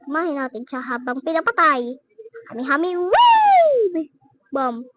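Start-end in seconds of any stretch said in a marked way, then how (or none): 2.5-4.1 mock anime attack cry; battle move call. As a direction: draw out the last word as a soaring shout. Calls out Goku's attack.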